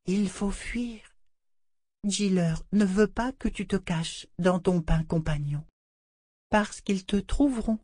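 put-on a doll consoling the child; tender doll voice. A narrator's reading. La poupée conseille. (voix de poupée tendre)

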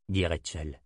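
plain reading voice

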